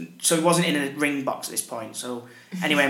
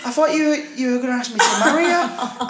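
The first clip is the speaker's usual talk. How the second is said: high indignant voice; imitating girlfriend; 9 semitones higher than usual. Girlfriend expecting a proposal, high disappointed complaint. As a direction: pitch it up, let down. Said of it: "she went".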